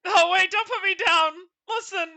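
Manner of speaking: high, loud mock sobbing plea; pleading horse. Horse character begging not to be put down, hammed-up crying. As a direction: beg for your life, half crying.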